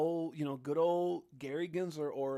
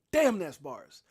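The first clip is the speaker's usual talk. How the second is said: louder, hyped repeat of the phrase; excited exclaim; 2 semitones higher, 11 dB louder, slower than usual. Real, not acted. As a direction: hype it up the second time. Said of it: "repeats phrase, second time louder".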